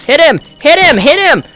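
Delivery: high, frantic game urging. Real, not acted. Player has five seconds left, frantic urging. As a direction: urge him on against the clock. Urges character against the clock.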